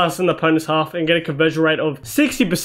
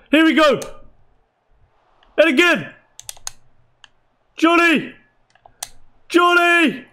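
high, short bursts of anticipation; excited calling; 10 semitones higher than usual. Real, not acted. Gamer urging Johnny toward goal, excited calling. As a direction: cry out the striker's name as the chance builds.

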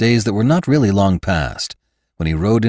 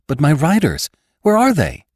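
high, urgent question; anxious alarm; 8 semitones higher than usual. Acted. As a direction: plead for news, pitch climbing sharply. Alarmed woman asks after her riders.

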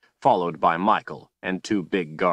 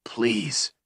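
begging in tears; 4 semitones higher than usual. A narrator's reading. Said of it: "Richard begged in tears".

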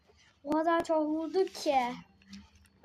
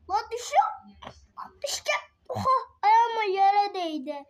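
screaming while falling; 4 semitones higher, 6 dB louder than usual. Real, not acted. Chair tipping, he is falling.

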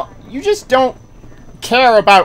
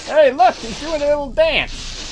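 ordinary talk, nothing marked

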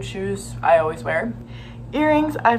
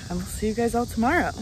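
ordinary talk

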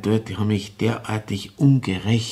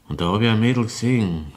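normal talking voice

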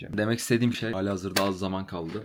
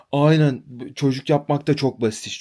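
loud, higher sarcastic jab; sarcastic mock; 4 semitones higher, 9 dB louder than usual. Tenant mimics the landlady's sarcastic retort, loud and mocking. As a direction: bark out her sarcasm mockingly. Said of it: "Kadın dedi ki".

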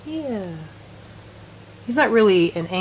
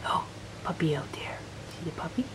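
whisper; 3 semitones lower, 12 dB quieter than usual. Real, not acted. Whispering to dog at window.

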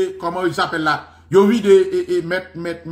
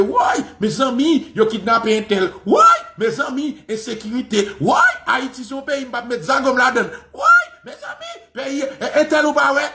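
hammed-up panicked lament, pitched high; mock alarmed outcry; 6 semitones higher than usual. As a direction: imitate people crying out in alarm. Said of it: "Kon y a otan de".

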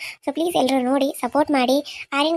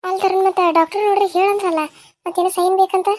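raised, lightly worried elder voice; concerned mother; 6 semitones higher, 3 dB louder than usual. Acted. Mother asking Taruna about the doctor's signature, concerned. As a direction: query him with mild concern.

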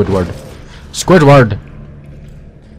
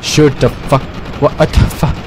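ordinary talk

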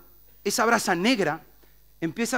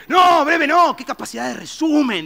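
high, loud quick correction; a hint of a know-it-all; correcting neighbour; 8 semitones higher, 7 dB louder than usual. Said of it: "dice el otro".

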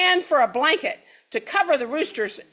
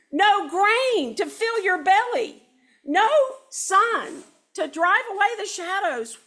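pleaded; 7 semitones higher than usual. Acted.

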